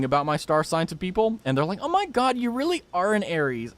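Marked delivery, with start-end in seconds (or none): 1.8-3.7 high, gushing Californian imitation; mock an astrology-mad Californian, pitched way up; put-on high Californian gush.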